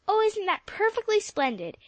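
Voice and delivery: Polly overjoyed at the pick; delighted cry. A narrator's reading. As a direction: gush with joy. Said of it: "cried Polly".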